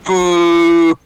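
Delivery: loud high mock boo. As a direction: jeer like a heckling crowd. Booing the made-up idiom.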